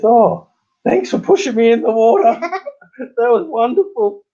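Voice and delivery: high, laughing fake gratitude; laughing mock thanks. Soaked mate thanks him.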